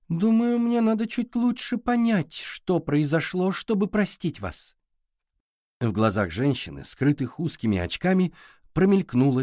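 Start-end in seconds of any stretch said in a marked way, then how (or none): 0.1-4.8 lightly voiced girl, pitched high; measured answer in a higher voice; reserved young Ridley. Girl to the librarian who wronged her, guarded reply.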